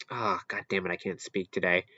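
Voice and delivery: quietly cursing under the breath; real frustration muttered low and soft; annoyed mutter. Real, not acted. Annoyed at stumbling over words.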